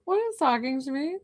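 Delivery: high mock-alarmed question, starting loud; retold startled reaction. Healer laughingly retelling her scare, mock-startled and high. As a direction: imitate yourself being startled, pitch climbing high. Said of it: "I was like".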